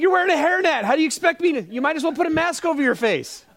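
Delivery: high-pitched comic protest; mock exasperation. Pastor excusing himself to hairnet lady, mock exasperated. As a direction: protest in a high voice, playfully defensive.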